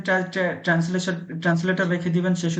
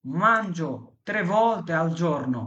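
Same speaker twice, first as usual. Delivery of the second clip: slow model sentence; 2 semitones lower, much slower than usual. Shown as a demonstration. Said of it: "teacher models Italian sentence".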